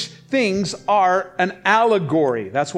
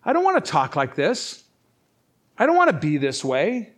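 ordinary talk, nothing marked

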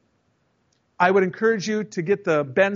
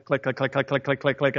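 radio clicks; 5 semitones lower, 4 dB quieter than usual. Retold or imitated. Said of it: "imitating radio clicks".

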